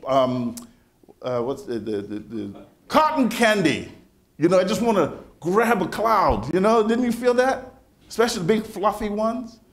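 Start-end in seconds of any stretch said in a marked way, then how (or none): 4.4-9.6 excited wonder. Real, not acted. Childhood longing to grab cloud.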